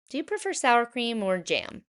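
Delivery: put-on a sweet old lady lifted high; Bubba Braina.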